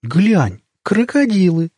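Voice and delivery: put-on high-pitched childish amazement; childlike surprise. A narrator's reading. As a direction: gasp it out like an astonished kid. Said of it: "по-детски удивилась Светка".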